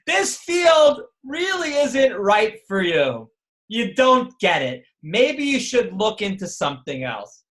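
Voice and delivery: a lightly voiced professor, high and slow, letting you down with mock politeness; respectful but crushing verdict, mildly hammed-up; condescending professor. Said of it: "but he says to you basically".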